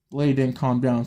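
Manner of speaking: plain reading voice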